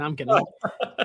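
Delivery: laughing through the words, slow. Real, not acted. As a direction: chuckle out I'm kidding. Laughing at his own joke.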